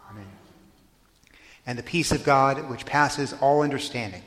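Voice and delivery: ordinary talk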